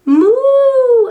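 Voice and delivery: long loud high moo; cow moo. Acted. Voicing a cow for a child, long loud moo.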